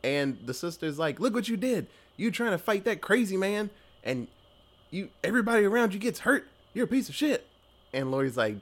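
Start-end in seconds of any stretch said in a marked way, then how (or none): 1.2-7.5 mock scolding, pitched high; lightly hammed-up angry telling-off; accusing sister. Sister blames Laurie for fighting that crazy man, mock accusing.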